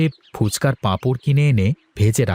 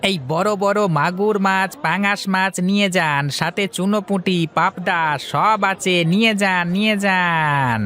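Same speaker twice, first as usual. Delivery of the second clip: market hawker calling; 7 semitones higher than usual. Acted. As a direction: sell your fish to passers-by, slowing at the end. Fish seller hawking at market. (উঁচু সুরে হাঁক দেওয়া মাছওয়ালা)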